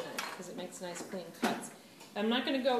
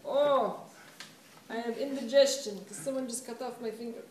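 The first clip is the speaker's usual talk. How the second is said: cowboy alien's put-on accent, louder opening; 2 semitones higher, 4 dB louder than usual. Teacher voices injured clay cowboy alien, put-on accent. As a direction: groan "oh" loudly, then complain in an odd accent. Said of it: "I don't know why that accent".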